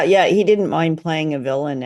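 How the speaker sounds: ordinary delivery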